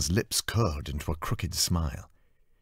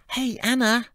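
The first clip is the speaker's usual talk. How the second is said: put-on much higher, bright louder voice of a chirpy young woman; cheerful assistant; 6 dB louder than usual. Department assistant calling cheerfully to Anna at the door. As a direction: bounce in with sunny energy. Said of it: "Her cheerful voice grated".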